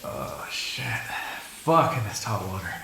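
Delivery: low, soft, drawn-out sigh of pleasure; blissful relief. Acted. Vampire eases into hot water, blissful relief. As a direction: let it sink out quietly.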